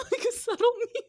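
high, loud squeal through laughter; laughing hard. Real, not acted. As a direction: barely get it out for laughing. Still laughing while explaining.

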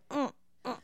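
soft pouty hum; baby talk whimper. Retold or imitated. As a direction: pout it out quietly.

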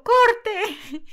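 high, loud film-set shout; director calling cut. Retold or imitated. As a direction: call "cut" across the set. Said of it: "el director dijo".

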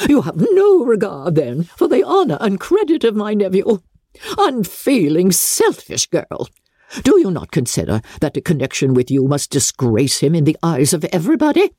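mild hammed-up fury; incensed. A narrator's reading. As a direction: scold her with rising outrage. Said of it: "Her ladyship was highly incensed".

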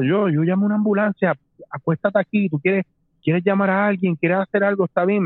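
helpful stranger. Retold or imitated. Stranger offering to call an ambulance, retold as urgently helpful. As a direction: imitate a bystander urgently offering help.

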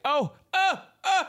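high gasping oh; imitating Andre. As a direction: mimic a delighted gasp, up high. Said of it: "he was like".

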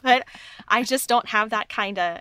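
usual speaking voice

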